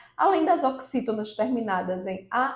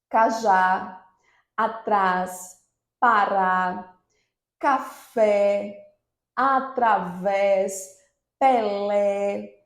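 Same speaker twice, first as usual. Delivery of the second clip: "cajá", "café", "Pelé": slow, final syllable held long; stress on last syllable; 3 dB louder, much slower than usual. Shown as a demonstration. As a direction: dictate "atrás" and "através" separately, leaning hard on the last syllable. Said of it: "a última sílaba mais forte". ("cajá", "café", "Pelé": última sílaba forte e alongada)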